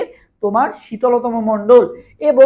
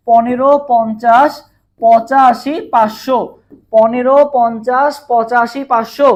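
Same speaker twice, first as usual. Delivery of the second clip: slow, louder chant, one number at a time; slow number drill; 5 dB louder, much slower than usual. Shown as a demonstration. Teacher leading students through the layer heights, slow louder chant. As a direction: spell out each number for the class to repeat. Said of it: "একটা সংখ্যা comment করো সবাই আমার সাথে সাথে".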